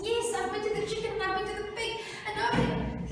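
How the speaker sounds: high, rushed mouse voice; desperate mouse. Acted. Mouse tells ox nobody would help, high and rushed. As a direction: rattle it out in a high plea.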